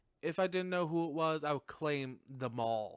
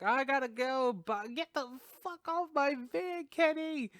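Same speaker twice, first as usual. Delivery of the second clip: high, loud mock frantic reporter; hammed-up high-pitched Gail; 10 semitones higher, 3 dB louder than usual. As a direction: squeal it like a panicked newswoman. Voicing Gail driving off.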